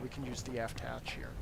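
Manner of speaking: soft unvoiced whisper to self; hushed whisper, half to yourself; whispered aside. Real, not acted. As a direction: whisper it under your breath as an aside. Muttered aside while navigating.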